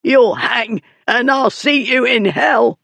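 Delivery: high, strained defiant threat; throttled bully's high-pitched defiance; choked out. A narrator's reading. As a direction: curse him while being strangled. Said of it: "the fool choked out".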